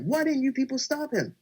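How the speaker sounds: high-pitched but softened retold yell; mock yelling. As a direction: plead in a high, held-back mock yell. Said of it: "I'm yelling to him".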